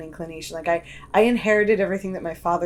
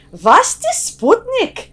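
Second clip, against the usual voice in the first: put-on thick Yiddish accent, high and slow; old Yiddish grandmother accent; 10 dB louder, slower than usual. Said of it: "she said in her, like, thick, you know, Yiddish accent".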